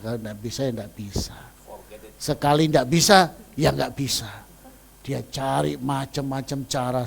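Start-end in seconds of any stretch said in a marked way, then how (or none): 0.0-1.4 quieter repeat. Shown as a demonstration. Preacher repeats the rule to the congregation, low and soft. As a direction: lower and softer, settled.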